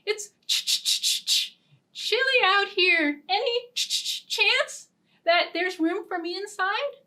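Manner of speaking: a cold squirrel begging to come in, teeth chattering. Acted. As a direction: shiver through it like a freezing squirrel. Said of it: "said with chattering teeth".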